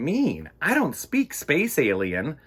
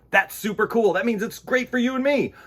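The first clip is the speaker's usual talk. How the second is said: higher, louder and thrilled; bright kid-show excitement; excited; 11 semitones higher, 5 dB louder, faster than usual. Acted. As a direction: gush it to the kids.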